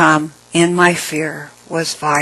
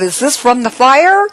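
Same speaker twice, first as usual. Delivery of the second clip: high, louder shocked question; mock-screamed question; retold scream; 8 semitones higher, 5 dB louder than usual. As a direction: imitate a horrified woman, pitch shooting up. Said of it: "screamed, and said".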